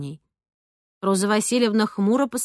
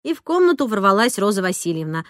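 ordinary delivery

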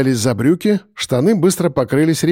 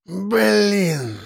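drawn-out, higher-pitched sigh; exhaled curse; 5 semitones higher, 3 dB quieter than usual. A narrator's reading. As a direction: breathe out your frustration on one word. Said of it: "выдохнул Архип". (на выдохе, с досадой)

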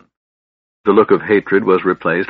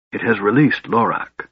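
usual speaking voice

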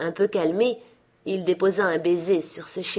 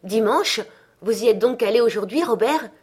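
higher, louder, quick; surprised question; 5 semitones higher, 5 dB louder than usual. A narrator's reading. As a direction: ask in startled surprise. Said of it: "demanda sa femme".

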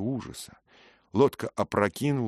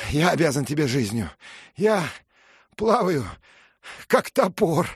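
excited; 10 semitones higher, 5 dB louder than usual. A narrator's reading. Dick thanks rescuer, excited.

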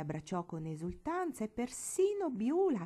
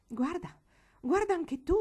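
rising alarm, much higher and louder on the second guarda; alarmed exclamation; 9 semitones higher, 6 dB louder than usual. Acted. Aunt calling Teresa to look at the child's head, alarmed cry. Stasia horrified, pointing at head.

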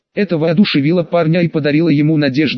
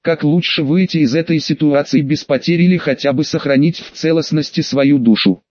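usual speaking voice

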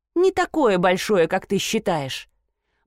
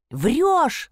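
high-pitched accusing cry; mild squeal; 7 semitones higher than usual. A narrator's reading. Daughter accuses her mother of lying, mild high squeal. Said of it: "завизжала Эмма".

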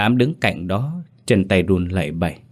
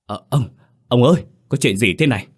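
halting, higher-pitched flustered voice; alarmed stammer; 4 semitones higher, 4 dB louder than usual. A narrator's reading. Panicked servant asking his master what happened, stammering. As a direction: stumble on the first words, then blurt louder. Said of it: "Thằng Lãm lắp bắp".

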